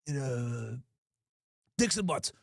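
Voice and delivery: low, hushed sleazy caller; pervy voice. Retold or imitated. Prankster gives the clerk a fake title, low and sleazy. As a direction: murmur the title like a creep. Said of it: "in a pervy voice".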